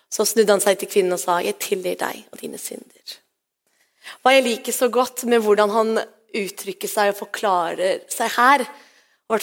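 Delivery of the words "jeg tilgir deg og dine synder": softer, lower, gentle voice of Jesus; tender forgiveness. Acted. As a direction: forgive her quietly and tenderly.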